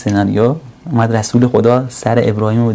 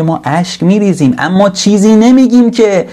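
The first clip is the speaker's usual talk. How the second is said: impassioned quote; 9 semitones higher, 5 dB louder than usual. Acted. Quoted Prophet, sudden high.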